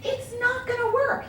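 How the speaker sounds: high, slow mocking husband voice; a hammed-up doubting husband; husband's scoffing. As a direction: mimic him scoffing at the plan. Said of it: "He goes".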